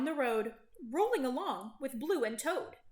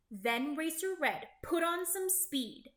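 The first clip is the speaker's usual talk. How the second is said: ordinary delivery, nothing marked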